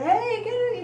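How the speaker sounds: loud, high yell; calling kids way out in the yard; calling across the yard. Retold or imitated.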